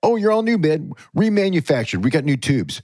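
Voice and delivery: squeaky mock pitch; mock cheerful sales voice. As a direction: gush like a repairman selling a refurbished amp. Mocking a repaired amp.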